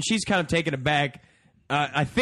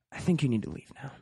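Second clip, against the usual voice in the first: quietly imitating her; 3 semitones lower, 6 dB quieter, faster than usual. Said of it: "looks down at my feet and goes".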